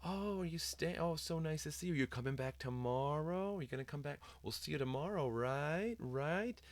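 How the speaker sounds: high, gushing office staff imitation; sing-songy phony voice. Office staff pressing a child to come back tomorrow, phony and sing-songy. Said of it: "That, that whole sing-songy, phony voice".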